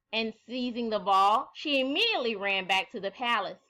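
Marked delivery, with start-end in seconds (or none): none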